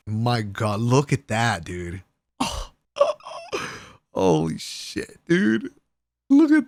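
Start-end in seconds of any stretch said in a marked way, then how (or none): 3.0-3.8 laugh. Real, not acted. Laughing at the cake.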